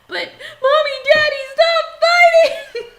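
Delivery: put-on high, loud child's wail; whiny child. Following husband through the store, a loud high child's wail. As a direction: shout like a kid begging parents to stop. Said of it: "I follow behind him going".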